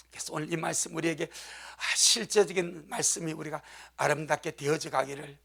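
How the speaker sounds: normal talking voice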